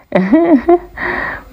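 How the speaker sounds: high cackling hyena laugh. Acted. Hyena laughing at excuses, high cackle. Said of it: "the hyena put in with a laugh".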